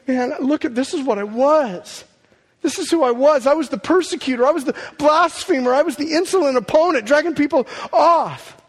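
high, loud, anguished Paul confessing; voicing Paul. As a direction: cry out as the repentant persecutor. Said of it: "just going".

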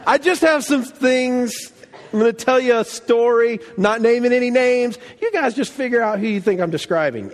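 Jesus teasing the Pharisees with a story; sly Jesus. Retold or imitated. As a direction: feign innocence while everyone knows who is meant. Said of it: "He's like".

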